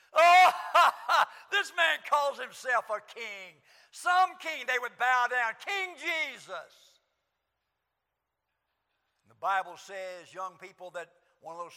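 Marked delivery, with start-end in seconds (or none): 0.1-6.7 jeering soldiers. Acted. Preacher acting soldiers scoffing at the would-be king, loud jeers. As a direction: laugh and shout in scorn at the prisoner.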